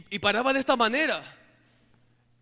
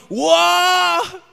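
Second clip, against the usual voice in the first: a sudden very high, very loud howl; demon howl; 10 semitones higher, 14 dB louder than usual. Retold or imitated. The demon answering the psalm with a shrieking howl. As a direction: howl like a beast struck by the verse. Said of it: "el demonio gritaba peor".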